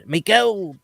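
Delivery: squeaky little leprechaun voice; high mock leprechaun. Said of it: "Do you have a leprechaun voice impression".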